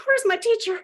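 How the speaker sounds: high, mock-bawled wail; bawling. Looking for her teacher, imitating her own bawling. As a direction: cry it out as she searches for him.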